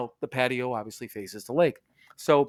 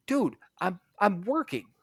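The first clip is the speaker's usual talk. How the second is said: indignant; 7 semitones higher than usual. Retold or imitated. Retold confronting the intruder.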